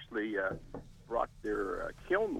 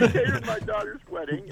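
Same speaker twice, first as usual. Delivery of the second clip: laughing; 8 semitones higher, 7 dB louder than usual. Real, not acted. Still laughing after the slip.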